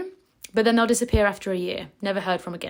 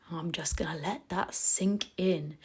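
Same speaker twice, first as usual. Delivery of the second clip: whisper; 3 semitones lower, 9 dB quieter than usual. Real, not acted. Lets a point sink in.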